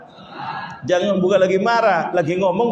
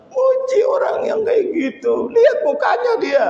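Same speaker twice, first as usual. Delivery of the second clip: mocking high voice. Mocking a husband praising others.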